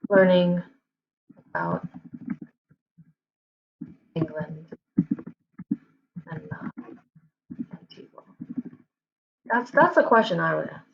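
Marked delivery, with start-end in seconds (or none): 0.1-8.2 isolated words with long gaps, tailing quieter; halting self-dictation, fading away; typing aloud. Real, not acted. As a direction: sound out each word as you type it. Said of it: "I'm typing like".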